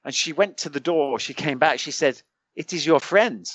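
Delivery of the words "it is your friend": rough imitation of a Norwegian accent; higher-pitched, put-on Norwegian English. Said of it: "not my best Norwegian accent".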